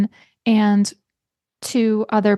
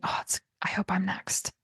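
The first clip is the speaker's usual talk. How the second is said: breathy unvoiced whisper of hope; whispered thought; 13 dB quieter than usual. Patient in the waiting room hoping to be called, whispered. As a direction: whisper it like a private wish. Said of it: "you know what everybody's thinking".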